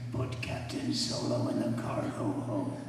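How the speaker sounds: low, slow, deliberate villain delivery; film character's line. Acted. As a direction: deliver the order low and drawn out. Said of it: "this was my opening line".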